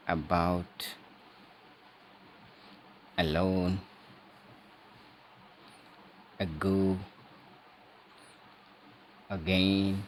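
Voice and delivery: teacher showing the vowel in "about, alone, ago, again"; schwa demo. Shown as a demonstration. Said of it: "इसमें ए को पूरा बोला जाता है".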